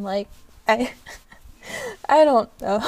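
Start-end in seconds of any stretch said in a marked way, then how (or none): 1.5-2.9 giggling through the words; laughing. Real, not acted. Laughing at her own swearing.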